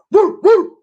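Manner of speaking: high, loud whoop of triumph; hyped cheer. Real, not acted. Hyped mid-rant cheer.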